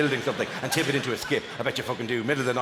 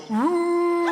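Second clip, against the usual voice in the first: mock hilltop call; 13 semitones higher, 3 dB louder than usual. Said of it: "go to a hilltop and go".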